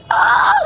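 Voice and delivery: very high sudden shout of shock; shocked shriek. Retold or imitated. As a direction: shriek in startled disbelief. Teacher's shocked reaction.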